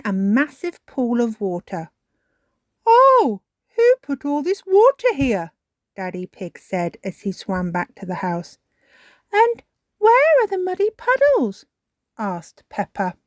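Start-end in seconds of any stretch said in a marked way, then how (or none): 2.9-5.5 high, loud, surprised question; startled outburst. A narrator's reading. Daddy Pig lands in a pool of water, startled. 9.3-11.7 put-on high, loud child's question; Peppa. A narrator's reading. Peppa finds her puddles under water, high and insistent.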